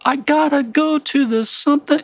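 high, loud sung phrase with the weight on "gotta" and "go"; singer-style stress demo. Shown as a demonstration. Teacher showing how English singers stress a line, high and loud. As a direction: belt "I gotta go to the something" with the accents leaning late. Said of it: "You're gonna stress the second word".

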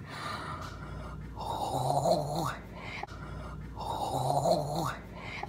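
a slow drawn-in gasp; long shocked gasp. Retold or imitated. Inwardly reacting to the betrayal, long gasp. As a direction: gasp like the floor dropped.

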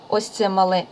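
ordinary talk, nothing marked

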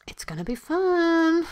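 high, drawn-out coaxing; wheedling. Acted. Student coaxing friends to skip class, wheedling. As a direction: stretch it out high to tempt them.

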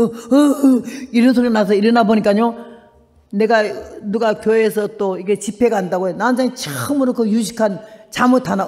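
normal talking voice

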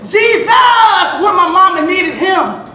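high, loud cry easing slightly; preacher's yell. Acted. Poet recalling papa praying for mama, full yell. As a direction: belt out the name, carry it through. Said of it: "He yelled".

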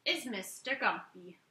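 ordinary delivery

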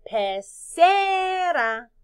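"pecera" with a long, high, loud CE; stress on second syllable. Shown as a demonstration. Teacher shows where the C is, stretching ce in "pecera". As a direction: draw out the ce in "pecera". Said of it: "Tiene la C en la segunda sílaba".

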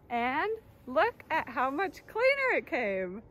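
slow, loud, amazed at the result; impressed reveal. Shown as a demonstration. Teacher revealing filtered water, slow and loud. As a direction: show off the clean water, drawn out.

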